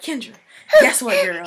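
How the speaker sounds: excited. Retold or imitated. Calling friend excitedly.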